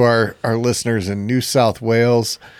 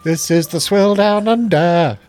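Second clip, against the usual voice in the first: slow, higher Australian ad voice; mock Australian accent; 6 semitones higher, 3 dB louder, slower than usual. Co-host parodies the ad he expected, mock Australian accent. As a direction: draw it out like an Aussie beer slogan.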